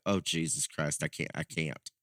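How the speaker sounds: resigned aside, low and soft; weary mutter. Retold or imitated. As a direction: groan it to yourself as trouble starts. Disbelief at the accusation.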